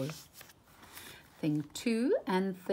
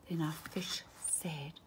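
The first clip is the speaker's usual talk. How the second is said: gasping whispered lead-in; breathless gasp; 3 semitones lower, 10 dB quieter than usual. A narrator's reading. Narrator gasps before the fish speaks. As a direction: catch your breath in alarm.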